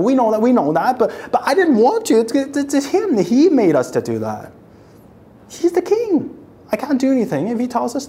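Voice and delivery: high, defensive pleading of a worshipper; pleading citizen. Acted. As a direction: protest your innocence to God.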